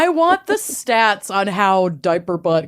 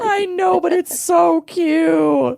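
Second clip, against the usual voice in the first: high, slow, gushing cute voice; cutesy gushing; 9 semitones higher, slower than usual. Real, not acted. Gushing over toddler again.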